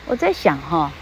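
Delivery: ordinary talk, nothing marked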